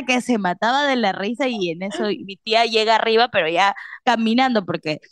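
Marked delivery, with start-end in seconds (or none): none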